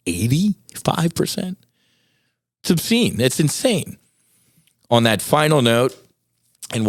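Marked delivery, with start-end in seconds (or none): 0.1-4.0 incredulous. Real, not acted. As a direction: rise up in outrage at how big it is. Incredulous at statistic.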